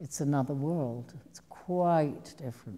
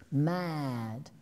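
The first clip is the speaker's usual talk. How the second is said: imitating her ex-husband. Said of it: "in that kind of voice".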